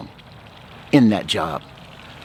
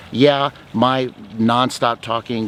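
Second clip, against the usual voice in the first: ordinary delivery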